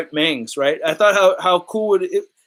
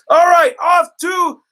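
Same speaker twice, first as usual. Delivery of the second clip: announcing shout; 11 semitones higher, 5 dB louder than usual. Real, not acted. Announcing a move to the garage.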